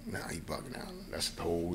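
lightly whispered aside to himself; a hint of an under-the-breath whisper; whispered inner thought. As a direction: hush it like a private thought. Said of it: "So now I'm like".

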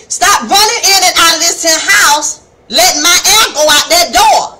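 imitating mama telling off children; scolding mama. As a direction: holler at the kids from across the house. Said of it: "like your mama would say".

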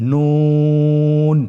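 নুন with the vowel held very long and thin; slow held recitation of নুন; long drawn vowel. Shown as a demonstration. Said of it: "আরেকবার চেষ্টা করুন".

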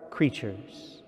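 ordinary talk, nothing marked

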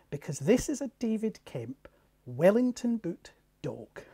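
normal talking voice

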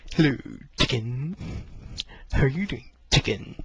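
light imitation of a friend; mildly put-on friendly greeting; a hint of Tekken's voice; Tekken impression. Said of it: "I had my go at a Tekken impression".

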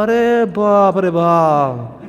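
long drawn-out cry of dismay; mock dismay. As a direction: stretch each word in mock shock. Demon aghast at the insult. (खींचकर नाटकीय हैरानी में)

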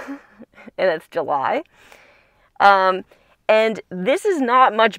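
speaking behind clear plastic; muffled by mask covering; through a face mask. Real, not acted. Seen 1: Transparent mask worn over her mouth and nose throughout.

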